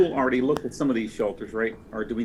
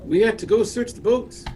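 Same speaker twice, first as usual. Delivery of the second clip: higher, mock Irish accent as Trotter; Irish accent act; 9 semitones higher than usual. Player voices Trotter urging search of boats, higher mock Irish. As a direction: play Trotter with a put-on Irish lilt. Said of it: "you don't have to do the act".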